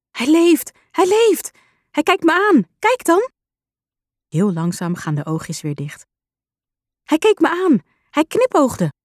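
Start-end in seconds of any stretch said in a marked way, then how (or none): 0.1-3.3 put-on high, bright child's voice bursting with joy; a little girl who can't believe her eyes; excited little girl. Child finds squirrel alive. 7.0-8.9 put-on high, bright child's voice bursting with joy; a little girl who can't believe her eyes; excited little girl. Child thrilled by squirrel.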